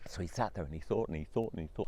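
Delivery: usual speaking voice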